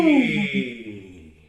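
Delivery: loud twangy wee, sliding down; piercing, but light as a sigh; piercing twang. Shown as a demonstration. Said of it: "really piercing".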